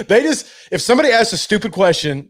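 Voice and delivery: ordinary talk, nothing marked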